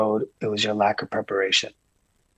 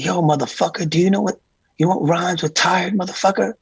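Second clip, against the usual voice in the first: [name] lecturing; 8 semitones higher than usual. Retold or imitated. Diddy scolding his worker about being tired, higher mock rant. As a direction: fire it up as an imitated boss.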